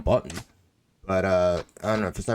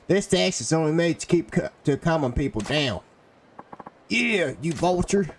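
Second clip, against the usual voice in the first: put-on southern accent; 8 semitones higher than usual. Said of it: "to do a southern accent".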